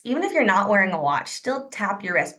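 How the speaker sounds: ordinary talk, nothing marked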